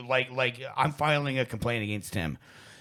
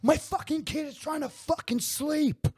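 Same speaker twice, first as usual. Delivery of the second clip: much higher, strained mock yell of a crazy neighbor; mock screaming neighbor; 14 semitones higher than usual. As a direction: imitate the psycho neighbor losing it. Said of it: "He starts screaming at them".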